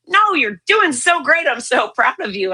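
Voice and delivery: the doctor won't take no, so push the no up high; insistent cheery praise. Retold or imitated. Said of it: "He goes".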